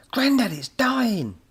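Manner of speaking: urgent yell. A narrator's reading. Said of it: "he yelled".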